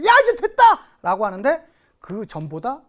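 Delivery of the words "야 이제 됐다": high, loud triumphant shout; hammed-up victory yell; acted cheer of triumph. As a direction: cry out in imitated jubilation. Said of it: "쾌재를 외쳤죠".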